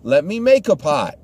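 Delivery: high hostess eagerly offering coffee; church lady voice. Retold or imitated. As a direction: insist sweetly, no trouble at all. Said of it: "And the other lady says".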